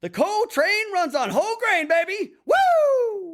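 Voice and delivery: high, hyped-up football-star voice; Cole Train. Acted. Quoting Gears of War to his friend, hyped-up high shout. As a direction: yell it like a pumped-up soldier. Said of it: "from another video game".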